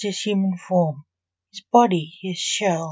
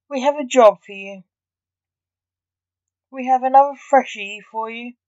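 orderly; 4 semitones higher, 4 dB louder than usual. Acted. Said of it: "said the orderly".

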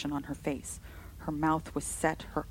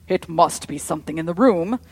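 much louder, cracking like a whip; lashing voice; 12 dB louder than usual. A narrator's reading. Henry declaring to his sisters, lashing. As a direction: lash them with it. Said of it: "in a voice which seemed to slap like a lash".

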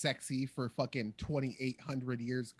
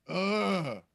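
loud, higher-pitched groan of disgust; 4 semitones higher, 4 dB louder than usual. Real, not acted. Viewer reacting to gross camera work, disgusted groan. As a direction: groan out your revulsion.

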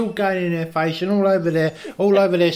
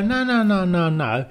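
ride attendant voice; 5 semitones higher than usual. Retold or imitated. Staff voiced.

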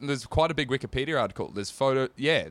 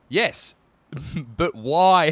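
normal talking voice

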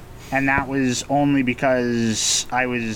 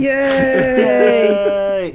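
loud high cheer; cheering shout; 10 semitones higher, 6 dB louder than usual. Real, not acted. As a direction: yell it out in celebration. Cheering the series start.

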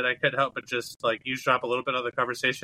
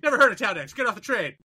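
raised, brusque imitated brush-off; mock dismissive; 7 semitones higher than usual. Retelling a lady turning away a passenger, mock dismissive. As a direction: mimic a curt refusal, higher pitched.

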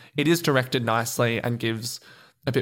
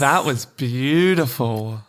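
mock awe; 5 dB louder, much slower than usual. Mock awe after the answer.

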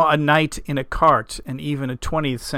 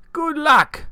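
much higher, louder farmer voice; old farmer send-off; 12 semitones higher, 5 dB louder than usual. A narrator's reading. Farmer leaving Keith at the bridge, breezy send-off. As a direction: toss it off as a high-voiced rustic.

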